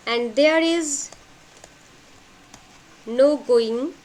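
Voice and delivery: slow dictation, long pauses between phrases; measured note-taking pace, broken by long silences. Shown as a demonstration. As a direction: dictate it a few words at a time. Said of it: "Note down".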